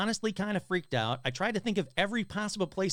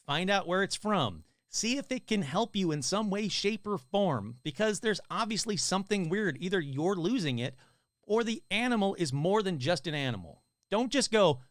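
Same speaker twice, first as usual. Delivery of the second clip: ordinary delivery, nothing marked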